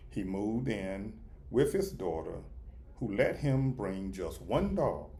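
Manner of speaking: ordinary delivery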